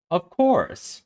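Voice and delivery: high, exaggerated sarcastic lilt. A narrator's reading. Trickster answering Amy, a note of sarcasm. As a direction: pitch it up with pointed sarcasm. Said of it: "with a note of sarcasm".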